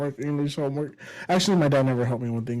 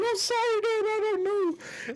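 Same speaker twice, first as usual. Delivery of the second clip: very high, drawn-out mock wail; yelling, pleading child; slower than usual. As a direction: imitate a panicked kid crying out to dad. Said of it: "I'm just yelling".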